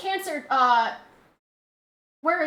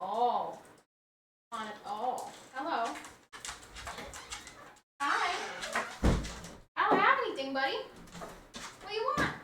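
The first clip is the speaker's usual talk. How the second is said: talking to the dog; 3 semitones higher, 11 dB quieter than usual. Real, not acted. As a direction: coax the dog gently, pitched up and hushed. Talking to her dog Jake.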